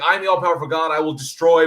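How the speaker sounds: a hammed-up almighty God; booming God voice. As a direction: puff up like a vengeful deity. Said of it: "he's like".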